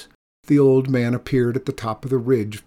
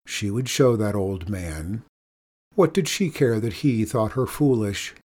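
usual speaking voice

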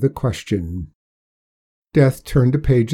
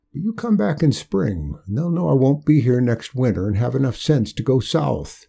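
put-on higher, firm old-timer refusal; stubborn old man; 3 semitones higher than usual. Old Hark voiced by narrator.

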